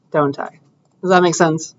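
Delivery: ordinary talk, nothing marked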